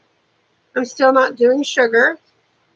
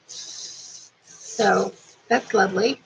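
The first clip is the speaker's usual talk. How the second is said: slow, soft, deadpan sarcastic aside; dry sarcasm; 4 semitones lower, 6 dB quieter, much slower than usual. Real, not acted. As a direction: let the sarcasm drip slowly. Sarcastic remark about alcoholic.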